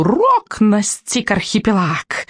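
high, slow screech of doom; shrieking witch, starting high and falling; witch's screech. A narrator's reading. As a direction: draw it out like claws on glass. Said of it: "взвизгнула ведьма".

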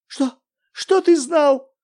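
a very high, loud, slow terrified question; в ужасе; in horror. Acted. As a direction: plead in horror. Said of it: "в ужасе спросила".

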